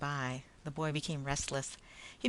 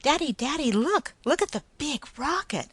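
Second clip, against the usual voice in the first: put-on excited child; 11 semitones higher, 9 dB louder than usual. Excited child calls father.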